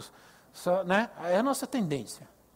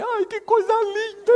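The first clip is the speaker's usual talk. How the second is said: much higher, louder doting coo; gushing parent; 7 dB louder than usual. Retold or imitated. Preacher mocking doting parents for the congregation: high, gushing coo. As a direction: coo over a newborn like a besotted parent. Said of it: "e a mãe e o pai".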